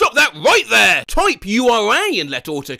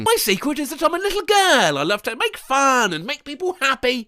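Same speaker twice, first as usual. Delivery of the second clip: put-on little girl voice; 7 semitones higher than usual. Reading childish autocomplete line.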